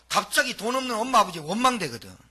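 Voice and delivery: normal talking voice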